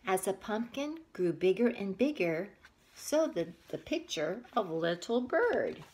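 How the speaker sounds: put-on reading a picture book aloud to kids; story narration. A narrator's reading. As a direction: tell it gently to little listeners.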